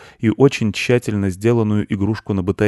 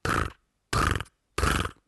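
three short low rolled trills; beetle trill; 10 semitones lower, 6 dB quieter, much slower than usual. Acted. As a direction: buzz three times like an insect. Beetle answers.

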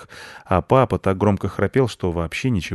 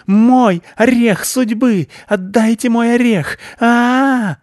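much higher, much louder, slow frantic screech; hysterical shriek; 9 dB louder, slower than usual. Acted. Hysterical squirrel screams for her nut. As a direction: scream as if losing your mind.

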